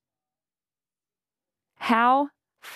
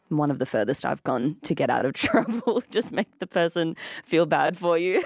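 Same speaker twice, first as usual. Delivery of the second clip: ordinary talk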